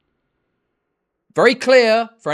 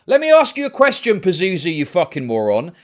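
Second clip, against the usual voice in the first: louder and higher, genuinely furious at him; angry shouting; 3 semitones higher, 7 dB louder than usual. Real, not acted. Streamer yelling at a chatter over the title, furious shout. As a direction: bark the question at him, fed up.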